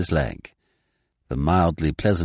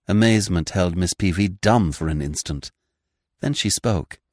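usual speaking voice

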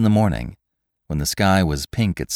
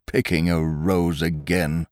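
grumbling; 2 semitones lower than usual. A narrator's reading. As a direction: grumble it under your breath. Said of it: "grumbled the old man".

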